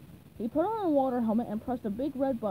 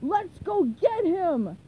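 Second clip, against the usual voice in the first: slow, loud rallying cry; rally a mob, drawing it out; raised, forceful shout; 5 semitones higher, 6 dB louder, much slower than usual. A narrator's reading. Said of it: "he shouted to the furious".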